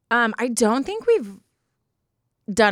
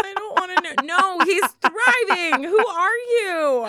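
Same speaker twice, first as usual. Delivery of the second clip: laugh-crying; 10 semitones higher than usual. Real, not acted. Laugh-crying over the robot.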